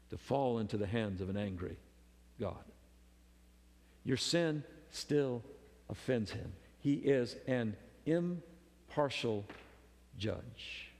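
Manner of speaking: hushed and grave; hushed solemn warning. Real, not acted. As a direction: let it sink in quietly.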